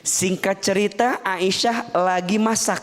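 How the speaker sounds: ordinary talk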